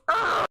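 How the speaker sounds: short, high, loud screech; imitated screech. Lady seeing the kid back at her door, imitated high screech. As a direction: mimic an outraged woman's wordless screech. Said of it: "like, she screeches kinda".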